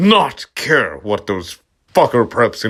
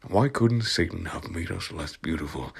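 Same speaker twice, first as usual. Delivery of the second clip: softer, subdued first take; quiet first read; 5 semitones lower, 10 dB quieter than usual. Acted. Reader tries the line softly, before the angry reread. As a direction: say it low and soft before the retry. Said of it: "I shouted angrily".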